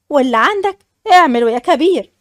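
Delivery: ordinary delivery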